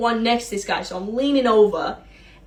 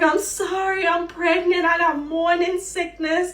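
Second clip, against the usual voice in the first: flustered apology; 7 semitones higher than usual. Retold or imitated. Apologizing to customer.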